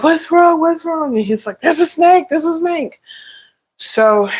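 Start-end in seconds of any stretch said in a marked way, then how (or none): 0.0-1.1 high, urgent worried questions; alarmed what's wrong. Retold or imitated. Mother panicking at son yelling. 1.6-3.0 put-on high, panicked kid voice; imitating scared son.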